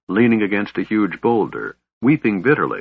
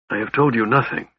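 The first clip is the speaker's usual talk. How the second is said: mildly testy, pitched up; a touch of irritation, voice held in; irritable; 4 semitones higher, faster than usual. A narrator's reading. Raistlin denying he told them anything, irritable. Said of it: "Raistlin said irritably".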